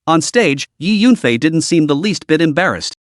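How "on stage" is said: ordinary delivery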